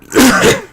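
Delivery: loud, real cough; sudden hacking cough; dry-throat cough. Real, not acted. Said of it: "my throat is actually dry".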